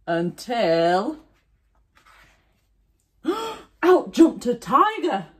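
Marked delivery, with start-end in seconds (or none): 3.8-5.3 sudden, high and loud; dramatic reveal. A narrator's reading. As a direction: burst out with the surprise.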